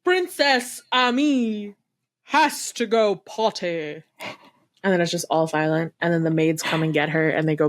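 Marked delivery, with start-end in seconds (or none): 0.0-4.0 slow announcer. Retold or imitated. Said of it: "and he goes".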